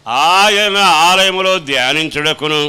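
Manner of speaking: loud, fervent scripture repeat; preaching at full force. Acted. Preacher echoing the psalm reading to the congregation, loud and fervent. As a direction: proclaim the verse back with fervour.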